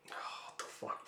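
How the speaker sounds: under-the-breath whisper; shocked whisper; whispered aside. Real, not acted. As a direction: hush it out in disbelief. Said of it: "own aside, whispered".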